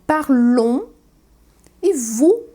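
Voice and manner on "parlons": Parlons with a nasal ending, no s sound; French parlons. Shown as a demonstration. Teacher models nous parlons for the class, nasal ending. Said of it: "que vai ser nous".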